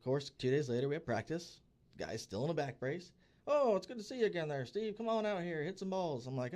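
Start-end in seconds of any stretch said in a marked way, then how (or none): 3.5-6.3 higher, chummy coach calling out to a dad; cheery coach call. Retold or imitated. Coach in a back brace waving a dad back out, high and chummy. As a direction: call him back onto the field, all friendly.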